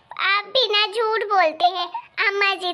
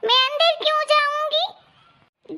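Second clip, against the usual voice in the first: put-on childish whine; 8 semitones higher, slower than usual. Wife refuses to go inside.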